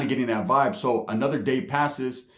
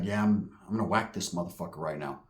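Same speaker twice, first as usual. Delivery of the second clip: hushed threat; 8 semitones lower, 7 dB quieter than usual. Retold or imitated. Hell's Angel states his plan quietly.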